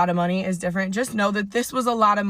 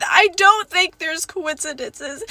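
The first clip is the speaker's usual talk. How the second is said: ordinary talk, nothing marked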